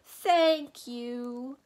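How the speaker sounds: loud, high, drawn-out groan. A narrator's reading. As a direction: groan out the words mournfully. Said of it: "groaned the din".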